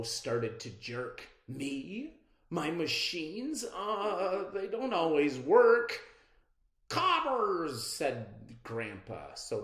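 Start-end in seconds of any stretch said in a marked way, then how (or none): 1.5-6.0 nervous. Acted. Izzy doubts herself. 6.9-7.8 exclaiming. Acted. Grandpa's exclamation.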